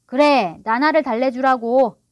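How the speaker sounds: loud, resentful bellow; shouting. A narrator's reading. Father resenting the comfort given the dog, shouting. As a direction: shout in sulky sarcasm. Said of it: "달링 씨가 고함을 질렀다".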